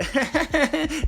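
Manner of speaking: high sleazy chuckle; lightly imitated creepy laugh; creepy mock laugh. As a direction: laugh like a leering older guy. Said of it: "And the guy's like".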